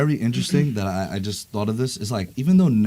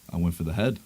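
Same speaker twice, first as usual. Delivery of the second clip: lower, softer grim voice; low grave Thor; 7 semitones lower, 7 dB quieter than usual. Retold or imitated. Thor quote.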